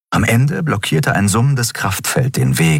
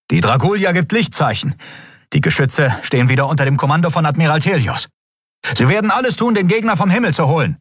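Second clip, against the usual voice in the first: high, quick battle report; urgent high-voiced officer; eager first officer; 10 semitones higher, faster than usual. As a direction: report good news fast and high.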